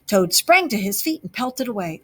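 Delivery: ordinary delivery, nothing marked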